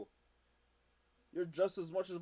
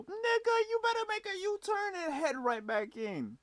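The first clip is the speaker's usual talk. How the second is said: incredulous scolding. Real, not acted. Streamer tells the man to turn himself in, incredulous and high. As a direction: cry out in disbelief at him.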